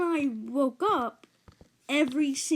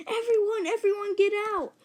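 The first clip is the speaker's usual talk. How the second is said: high, louder frantic call; panicked babysitter; 10 semitones higher, 4 dB louder than usual. Retold or imitated. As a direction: mimic a babysitter frantically clearing the room. Panicked babysitter orders guests out.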